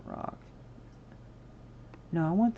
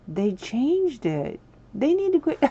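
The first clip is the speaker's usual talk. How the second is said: high, loud exasperation; high loud mock complaint; mock outrage; 8 semitones higher, 8 dB louder than usual. Real, not acted. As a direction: protest the change playfully. Mock outrage at game update.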